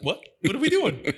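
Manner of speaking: high squeaky bewildered turkey; turkey puzzled before chop; turkey's voice. Retold or imitated. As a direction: play the dumb confused turkey. Voicing the turkey.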